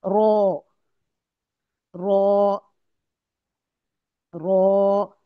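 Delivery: रो kept short, clearly recited. Shown as a demonstration. Teacher drills short letter names for students, crisp recitation. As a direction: clip रो short. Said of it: "इसकी मश्क करते हैं".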